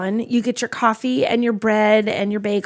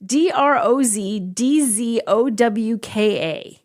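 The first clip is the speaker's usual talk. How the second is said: "D R O Z D Z O W K A" slowly, letter by letter; spelling it out; much slower than usual. Shown as a demonstration. Spelling the pastry name for co-host, letter by letter.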